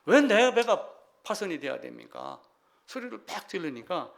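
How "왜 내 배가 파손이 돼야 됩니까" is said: raised, loud cry of complaint; retold shout to God. Preacher voices shipwrecked pirate shouting at God, loud retold cry. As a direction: imitate a pirate calling out to heaven. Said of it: "하늘을 향해서 이제 소리를 지르는 거예요".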